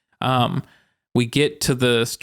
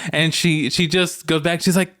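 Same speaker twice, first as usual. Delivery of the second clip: ordinary talk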